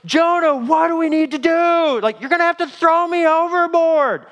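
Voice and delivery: high, strained shout over a storm; screaming over wind. Acted. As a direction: yell it high to be heard over howling wind. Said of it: "they're screaming this at each other".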